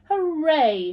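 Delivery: loud, high cheer; shouting hooray. A narrator's reading. Friends cheer together at the pond, loud and high. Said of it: "shouted Little Beaver and ... turtle all together".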